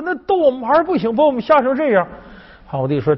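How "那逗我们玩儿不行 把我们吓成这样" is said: high-pitched protest, getting louder; aggrieved little groom. Acted. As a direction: complain loudly in a put-on high voice. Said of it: "这侏儒说". (小矮人委屈地尖声抗议)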